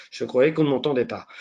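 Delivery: ordinary talk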